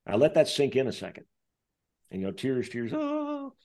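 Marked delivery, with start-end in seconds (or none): 2.9-3.5 high, soft tearful oh; imitated tearful oh, much higher and softer; mock whimper. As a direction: whimper through tears. Housekeeper in tears.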